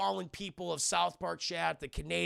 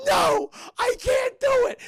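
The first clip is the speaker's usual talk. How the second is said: very high, loud, slow shriek; shrieking refusal; 11 dB louder, slower than usual. Retold or imitated. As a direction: scream the no at the top of your voice. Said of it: "And she goes".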